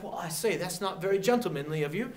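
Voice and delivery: hammed-up British gentleman accent; bad British accent. Teacher imitating British hating guerrilla tactics, bad British accent. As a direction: put on a mock-offended British accent. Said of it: "I'm sorry about that accent".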